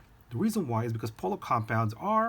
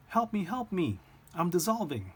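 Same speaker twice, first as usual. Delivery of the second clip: mock freak-out; 8 semitones higher than usual. Polar bear fallen in stream begs friend, mock freak-out. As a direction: play a panicking bear up high, hammed-up. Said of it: "the polar bear starts freaking out".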